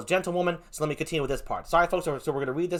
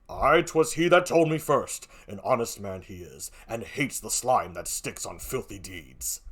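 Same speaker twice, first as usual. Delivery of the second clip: slow voice sinking very low and soft; Othello, grave; 7 semitones lower, 3 dB quieter, slower than usual. Acted. Othello vouches for her husband, low and grave. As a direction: pronounce it deep and solemn.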